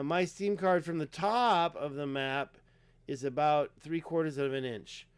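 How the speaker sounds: normal talking voice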